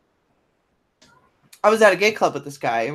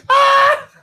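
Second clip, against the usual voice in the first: high, loud laughing squeal; delighted shriek; 6 dB louder than usual. Real, not acted. Storyteller cracking up at an outrageous TV moment, delighted shriek.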